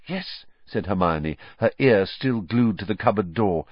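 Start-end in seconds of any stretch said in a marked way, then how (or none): 0.1-0.4 hushed. A narrator's reading. Hiding in cupboard.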